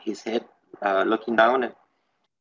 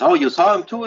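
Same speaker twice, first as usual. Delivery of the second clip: a higher, louder startled question; retold surprise; 8 semitones higher, 5 dB louder than usual. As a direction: imitate his sudden surprise. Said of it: "And then he goes like this".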